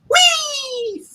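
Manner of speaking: long, high, drawn-out whee; gleeful whee. Acted. Spider-Man swinging after the helicopter, a gleeful stretched whee. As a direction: let the whee soar up and stretch out.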